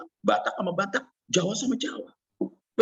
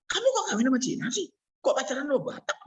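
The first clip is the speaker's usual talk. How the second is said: disapproving parent; 10 semitones higher, faster than usual. Retold or imitated. Voicing a disapproving Javanese parent.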